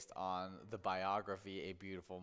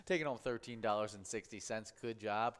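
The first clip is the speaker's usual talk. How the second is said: ordinary talk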